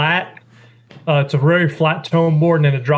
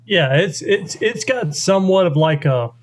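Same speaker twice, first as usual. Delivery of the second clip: usual speaking voice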